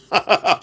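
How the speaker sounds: a quick knowing laugh; chuckle. Real, not acted. Warning anyone breaking into the house, a short chuckle.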